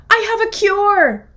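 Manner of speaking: high, loud call upward; shouted up. Acted. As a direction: call out to the wolf above. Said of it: "The duck shouted up".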